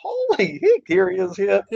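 high-pitched excited exclamation; amazed reaction. Retold or imitated. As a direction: blurt it out high, reliving the surprise. Retelling amazed reaction to passing car.

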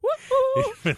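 squeaky high woohoo; high cheer. Pretend. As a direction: whoop it up high.

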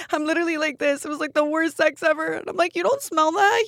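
high-pitched, barely holding back laughter; squeezed out through real laughter; tickled by your own awful story; laughing while telling. Real, not acted. Still laughing over own story.

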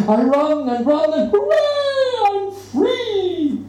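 the cat escapes into the street, overjoyed; joyful escape. A narrator's reading. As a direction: burst out in delight.

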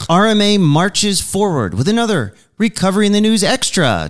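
upbeat show host intro; announcer voice. Acted. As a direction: open the show like a radio host. Podcast intro read.

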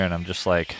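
ordinary talk

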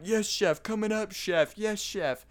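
sarcastic yes chef. Retold or imitated. Teen cook mocking angry managers, sarcastic. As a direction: play the eager line cook, dripping sarcasm. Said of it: "I'm like".

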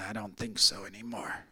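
a dying man quietly admits he now believes; soft frail voice. Retold or imitated. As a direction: murmur it like a weak old man.

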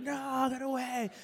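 slow, high drawn-out wail; mock emotional drunk. Preacher mimics an emotional drunk pushing people away, wailing. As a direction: drag the words out like an emotional drunk. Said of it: "you become an emotional drunk".

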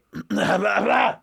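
sudden high, loud coughing. Real, not acted. Host interrupting his own parking lot story, loud cough. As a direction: cough hard, mid-story. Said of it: "I just have a small cough".

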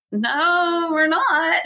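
high, drawn-out sheepish no; reluctant retold refusal. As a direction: imitate a nurse gingerly breaking bad news. Voices nurse reluctantly breaking news.

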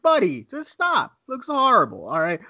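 mock stern buddy talk; exasperated. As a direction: tell a friend to quit kidding himself. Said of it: "And I'm like".

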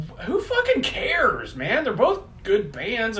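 high, drawn-out exasperated outburst. Retold or imitated. Retelling his reply to obnoxious Rush fans, exasperated. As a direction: stretch out the key words in fed-up disbelief. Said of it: "it just drove me batshit".

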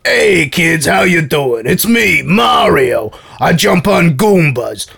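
mock American Mario commercial voice; Mario ad actor. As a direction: sell it to kids like a hammy TV actor. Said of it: "some actor and he would always be like".

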